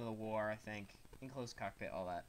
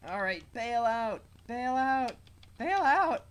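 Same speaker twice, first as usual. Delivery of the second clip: urgent yelling, much higher; shouting; 11 semitones higher, 12 dB louder, slower than usual. Pretend. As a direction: yell bail out in panic. Urging pilot to eject.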